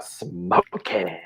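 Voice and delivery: drawn-out, cartoonish catchphrase; playful, elongated movie-character impression; Mask catchphrase imitation. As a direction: stretch it out like a cartoon show-off. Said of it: "the Jim Carrey mask movie".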